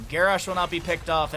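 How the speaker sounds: ordinary delivery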